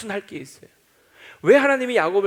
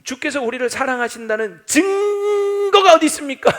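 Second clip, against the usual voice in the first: demanding; 7 semitones higher, 6 dB louder than usual. Retold or imitated. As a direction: press God for proof. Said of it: "이러면서 따지는 거예요".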